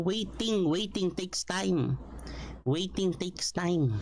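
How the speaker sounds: WAITING stressed, slow and falling; emphatic repetition. Shown as a demonstration. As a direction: lean on waiting, slowly. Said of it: "sabi ko na sa inyo".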